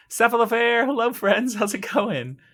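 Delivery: bright, high, loud hello to chat; high, eager welcome; excited greeting. Real, not acted. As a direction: greet the chat with a big lift.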